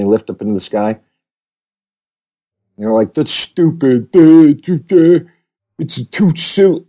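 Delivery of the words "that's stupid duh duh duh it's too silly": dopey put-on voice, higher, with a loud duh duh; hammed-up sneering fan; mocking whiny critic. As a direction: parody the complainers as dimwits. Mocking the show's complainers.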